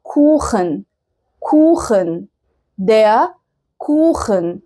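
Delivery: "كوخن" as Kuchen, long u and throaty kh; word-by-word "der كوخن" in careful German; German ach sound. Shown as a demonstration. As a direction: model "كوخن" slowly with back-of-mouth خ. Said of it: "زي كلمة".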